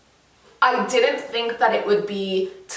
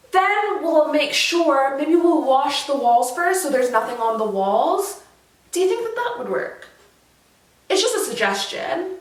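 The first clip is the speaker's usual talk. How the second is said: high, hesitant, sugary hedging; mock-tentative, dressing an order up as a suggestion; overly polite British hint; 4 semitones higher, slower than usual. Imitating English people politely hinting a painter should redo it. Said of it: "They would say it like".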